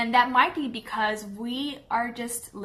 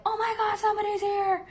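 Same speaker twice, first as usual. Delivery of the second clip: high, startled yelp of a dog hearing a knock; panicked dog voice; 10 semitones higher than usual. Retold or imitated. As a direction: voice the dog freaking out at the door, much higher. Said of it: "they're like".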